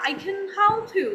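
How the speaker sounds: slow, high demonstration of English accent; imitated English accent, twist of the tongue on "help"; native English accent. Shown as a demonstration. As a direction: draw it out like a native English speaker. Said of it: "अंग्रेज़ों का style adopt किया".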